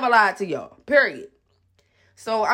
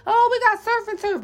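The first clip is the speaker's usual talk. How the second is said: mocking voice; 12 semitones higher than usual. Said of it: "they always trying to catch a deal".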